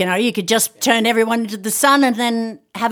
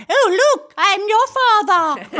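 much higher, silly Vader line; imagined undubbed Vader; 10 semitones higher, slower than usual. Pretend. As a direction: spoof the big reveal in a high voice. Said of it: "I thought it would be like".

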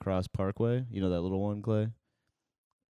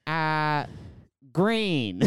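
high-pitched hesitant answer, then louder blurt; mock pondering, pause, then blurt it higher; Lori Lightfoot; 6 semitones higher, 3 dB louder than usual. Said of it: "And she takes a second".

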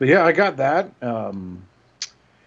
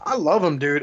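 ordinary talk

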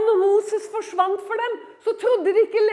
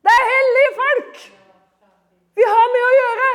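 forceful preaching; 3 semitones higher, 7 dB louder than usual. Real, not acted. Preacher defending believers as holy people, building in force. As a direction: drive it home, rising to a near shout.

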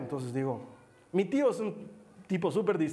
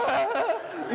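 high startled mock shriek; imitated frightened scream; 9 semitones higher than usual. Mimicking his uncle fleeing in pajamas, comic fright scream. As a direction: scream like a terrified uncle, played for laughs. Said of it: "lo veo a mi tío en pijamas que dice".

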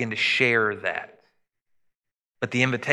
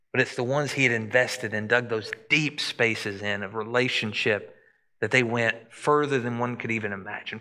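ordinary talk

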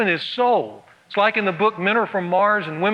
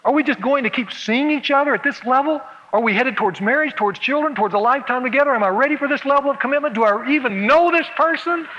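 higher and faster, spiralling anxious questions; panicky Elaine; 6 semitones higher than usual. Acted. Elaine spiralling in her head about commitment, panicky. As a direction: let the worry run away with her.

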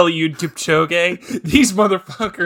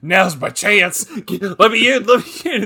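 mock creepy old man; 3 semitones higher than usual. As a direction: beg at the door like a sly coked-out old man. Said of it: "this crack is just like".

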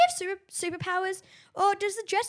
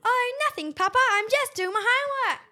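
Rebecca voice; 7 semitones higher, 5 dB louder than usual. Acted. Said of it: "That's not how she sounds".

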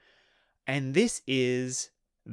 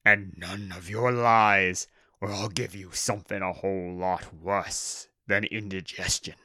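threatening orc boss; 2 semitones lower than usual. Acted. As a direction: warn them off as a brutish orc boss.

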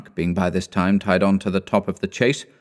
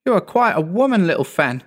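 put-on higher, delighted boyish exclaim; 10 semitones higher than usual. A narrator's reading. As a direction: cry out fondly at the little sister. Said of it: "exclaimed the boy".

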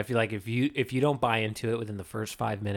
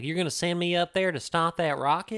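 exaggerated Southern accent; 6 semitones higher than usual. Podcaster mocking the actor's on-and-off accent, exaggerated Southern. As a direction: lay the Southern twang on thick. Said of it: "He has this Southern accent in the movie".

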